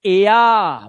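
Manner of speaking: एया said loud, stretched into two vowels; emphatic demo. Shown as a demonstration. Teacher calls out the sound to the class, loud. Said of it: "देखो ध्यान से".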